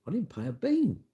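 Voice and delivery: ordinary talk, nothing marked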